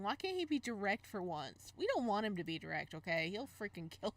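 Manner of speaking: ordinary talk, nothing marked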